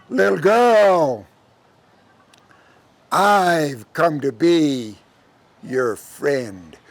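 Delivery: slow, drawn-out sinister stranger; El Diablo character. Acted. Devil luring a lonely girl, slow and drawn out. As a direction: stretch each phrase, sinking low at the end. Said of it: "And that person said".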